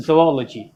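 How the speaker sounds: ZOOLOGY slowly as zo-OL-o-jee; correct pronunciation. Shown as a demonstration. Teacher gives an example sentence, zoology as "zo-ol-o-jee". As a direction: say zoology with two O sounds, no zoo. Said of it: "इस तरह से बोलेंगे".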